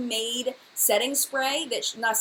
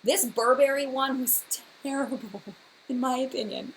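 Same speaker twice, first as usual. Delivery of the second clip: normal talking voice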